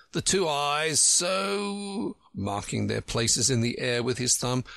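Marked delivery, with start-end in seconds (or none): 0.1-2.2 drawn-out demonstration. Acted. Humpty demonstrating eyes slowly.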